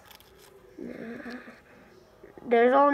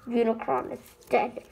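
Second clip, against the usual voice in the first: normal talking voice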